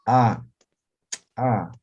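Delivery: "A" popped out loud and sharp; explosive t. Shown as a demonstration. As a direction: let the "A" burst out. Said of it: "Y explosiva". ("a" explosiva, con golpe)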